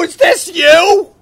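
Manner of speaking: high, loud, slow disbelief; incredulous shout. Retold or imitated. Husband asking the clerk on the phone, incredulous high shout. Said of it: "I go".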